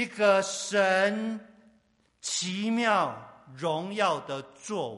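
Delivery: very loud, drawn-out words; stretched, booming declaration; grand proclamation. Real, not acted. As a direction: proclaim it slowly and forcefully from the pulpit. Preacher proclaiming God's glorious work.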